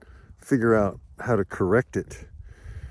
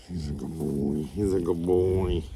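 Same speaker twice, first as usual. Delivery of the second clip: low, soft cooing to the dog; soft dog praise; 7 semitones lower, 6 dB quieter than usual. Real, not acted. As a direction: murmur praise to a good dog. Praising guardian dog Spike softly.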